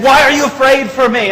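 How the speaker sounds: high, loud indignant question; retold shouted demand. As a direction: demand it as the baffled policeman. Policeman demands an answer, retold.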